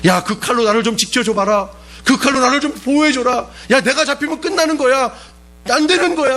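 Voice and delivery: hammed-up frightened plea for protection; mock panicked plea. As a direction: whine like someone desperate not to be caught. Imagined cowardly Jesus pleading.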